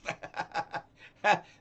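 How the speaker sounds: a real airy laugh; breathy laugh. Real, not acted. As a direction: burst out laughing. Laughing at the character.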